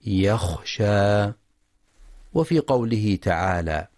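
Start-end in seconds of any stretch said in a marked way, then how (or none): 0.1-1.3 يخشى slowly with a clear, rasped khaa; يخشى with a distinct khaa sound. Shown as a demonstration. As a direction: say يخشى with a full khaa, not a ghain. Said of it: "والصواب تحقيق الخاء".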